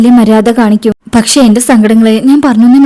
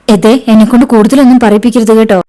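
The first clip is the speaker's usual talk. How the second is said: usual speaking voice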